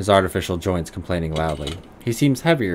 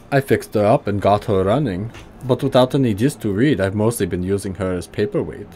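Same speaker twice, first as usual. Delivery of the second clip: put-on gruff German accent, pitched higher; 2 semitones higher than usual. Old tinkerer greeting the player in a higher gruff German accent. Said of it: "high German voice".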